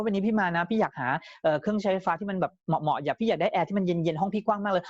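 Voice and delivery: quick, run-on customer chatter, pitched slightly up; fast-talking customer. Acted. Customer telling salesman what appliance they want, talking fast. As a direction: rattle it off in a rush. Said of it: "ถ้าลูกค้าเป็นคนพูดเร็วๆ".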